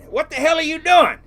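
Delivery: high, loud mock yell of the old man; retelling the old man's outraged phone call, loud; angry yell. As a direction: bark it like a furious old boss.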